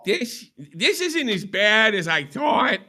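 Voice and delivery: high, very slow put-on voice; slow, drawn-out sultry voice; Phantom impression. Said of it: "give you another shot".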